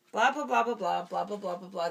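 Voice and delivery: fading blah blah to wrap up; mock skipping. Dad teasing child by skipping the story. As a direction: rattle off nonsense to end it. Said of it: "the end".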